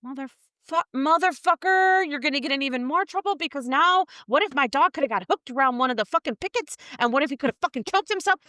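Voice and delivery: imagined rant at her partner, fast and heated; pretend rant. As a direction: tear into him, racing. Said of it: "I'd be like".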